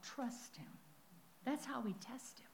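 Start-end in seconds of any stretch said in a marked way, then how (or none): 1.4-2.5 soft whisper; whispered point. Real, not acted. Preacher whispering the lesson to the congregation. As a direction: drop it to a whisper.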